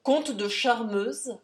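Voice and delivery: ordinary delivery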